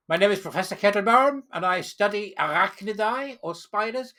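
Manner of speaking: put-on hammy German professor accent introducing himself. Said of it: "I'm doing a German accent".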